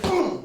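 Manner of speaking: quick high boom mid-sentence; punchy strike noise; imitated impact. Shown as a demonstration. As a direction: drop in the sound of the pad hit. Imitates hitting the pad.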